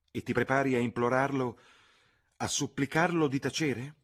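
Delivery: alarmed outcry; genuine alarm. A narrator's reading. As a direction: cousin aghast at the idea. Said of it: "cacciò un grido di allarme genuino".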